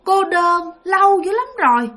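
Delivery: put-on a lonely lost child lamenting; weeping child ghost. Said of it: "ngồi đó khóc một hồi". (giọng con nít khóc than, cao)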